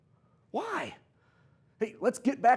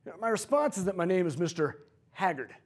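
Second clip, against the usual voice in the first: ordinary talk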